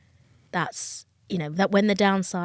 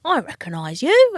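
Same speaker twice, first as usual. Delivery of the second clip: higher, louder mock bailiff recognising someone; put-on bailiff voice, pitched up; imitated bailiff; 5 semitones higher, 7 dB louder than usual. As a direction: play a bailiff spotting a known face, voice swooping up. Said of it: "a bailiff knocking on your door and they're going".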